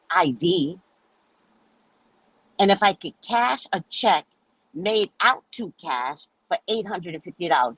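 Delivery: ordinary delivery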